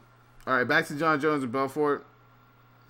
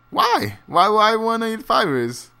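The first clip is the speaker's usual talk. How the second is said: exasperated why; 8 semitones higher, 4 dB louder, slower than usual. Real, not acted. Exasperated rant about matchmaking.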